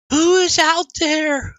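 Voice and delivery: high, drawn-out female character voice; woman's voice. Acted. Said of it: "You hear a woman's voice".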